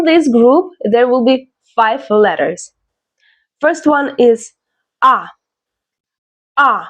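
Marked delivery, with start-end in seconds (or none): 5.0-6.8 letter A as a clear, isolated "ah"; open "ah" for A. Shown as a demonstration. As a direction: say A as in father. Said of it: "like in father".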